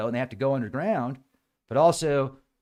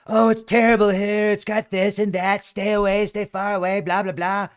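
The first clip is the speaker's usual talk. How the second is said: higher, louder mock grumpy local; negative commenter; 7 semitones higher, 6 dB louder than usual. Mocking a cranky online commenter, higher mock grumble. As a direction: sneer it like a cranky internet commenter. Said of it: "started blasting all these negative comments".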